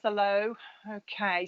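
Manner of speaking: ordinary talk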